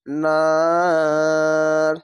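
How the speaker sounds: নার held very long, three counts; three alif stretch. Shown as a demonstration. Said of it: "তিন আলিফ টেনে পড়বেন".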